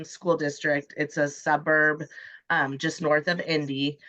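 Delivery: ordinary talk, nothing marked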